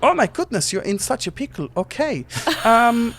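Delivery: squeaky little genie gushing over Titus; small Germanic genie. Acted. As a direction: play a tiny fussy genie, flustered. Genie reacts.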